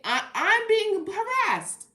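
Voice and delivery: high, loud, slow wail of protest; hammed-up high wail; dramatic complaint. Real, not acted. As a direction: drag it out like a victim. Exaggerated complaint.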